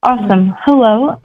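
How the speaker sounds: usual speaking voice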